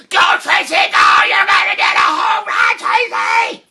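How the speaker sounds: very high, loud scream from the stands; screaming cheer. Retold or imitated. Parents in the stands screaming Tracy home, all-out. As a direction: scream your lungs out for her. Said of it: "we're screaming like we've never screamed".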